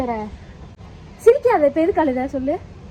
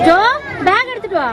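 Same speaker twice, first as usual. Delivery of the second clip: calling out; 9 semitones higher, 6 dB louder than usual. Real, not acted. Mother calls son at school.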